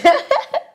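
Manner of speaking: a high, loud laugh; high laugh at her own story; rising laugh. Real, not acted. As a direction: laugh loudly at yourself. Laughs at walking alone.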